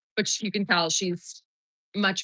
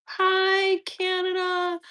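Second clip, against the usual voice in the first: high, drawn-out hello; cooed greeting; 10 semitones higher, much slower than usual. Real, not acted. Streamer greeting a viewer in Canada, high cooed hello. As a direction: sing out a friendly hello, up high.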